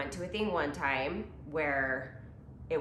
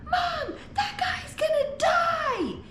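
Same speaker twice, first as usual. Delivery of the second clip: put-on high excited child shouting; kid voice; 5 dB louder, slower than usual. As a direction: squeal it like a little kid. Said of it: "and he goes".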